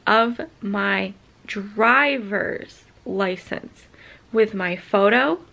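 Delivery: ordinary talk, nothing marked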